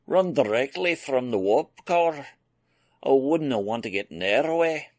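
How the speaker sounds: a hearty Scots engineer answering the lad; a lightly voiced Scottish engineer, cheerful; Scottish brogue. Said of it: "in his thick Scottish brogue".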